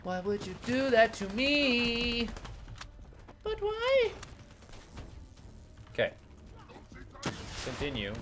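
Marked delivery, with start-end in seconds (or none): 0.1-4.1 slow, higher, drawn-out whine; whiny complaint. Real, not acted. Player whining at the enemy who shot him, slow and high. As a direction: stretch it out like a wounded plea.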